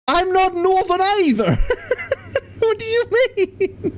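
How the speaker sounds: very high-pitched, laughing indignation; squeaky-high amused objection; laughing protest. Real, not acted. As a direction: protest through helpless laughter. Laughing protest at being called Northern.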